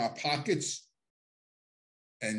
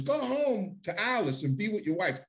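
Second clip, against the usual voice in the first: higher, insistent fatherly scolding; imitating John; 7 semitones higher than usual. John Gotti ordering him home to his wife, higher scolding voice. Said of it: "He goes".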